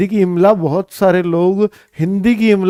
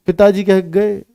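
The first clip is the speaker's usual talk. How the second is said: normal talking voice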